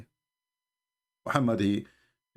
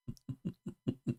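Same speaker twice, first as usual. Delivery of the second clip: breathy scoffing laugh; a short derisive laugh; mocking laugh; 9 semitones higher, 11 dB quieter than usual. Real, not acted. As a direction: scoff under your breath.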